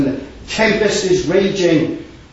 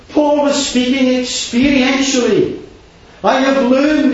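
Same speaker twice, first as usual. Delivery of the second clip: fervent preaching; 9 semitones higher, 5 dB louder than usual. Acted. Preacher peaks on Paul's words.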